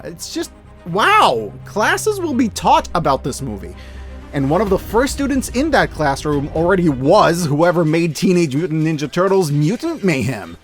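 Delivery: normal talking voice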